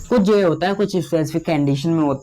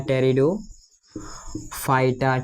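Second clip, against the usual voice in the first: very slow, low and softer, with gaps; slow dictation; 4 semitones lower, 5 dB quieter, much slower than usual. Real, not acted. Teacher dictates a plant group to students, slow dictation. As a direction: break the term up for writers. Dictating a term for note-taking.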